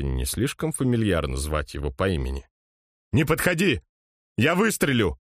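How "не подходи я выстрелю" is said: panicked shout. Acted. Gunman threatens approaching man.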